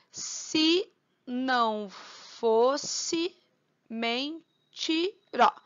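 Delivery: very slow, stretched syllables; slow scansion of a verse for counting. Shown as a demonstration. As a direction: draw out and separate each syllable. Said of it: "Um, dois, três".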